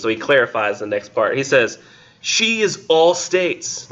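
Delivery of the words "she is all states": high, slow, theatrical poetry recital; lofty slow recital, pitched high; weird dramatic reading voice. Acted. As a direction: declaim it like an old stage actor. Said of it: "read this with this weird voice".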